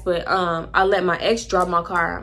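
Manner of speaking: ordinary talk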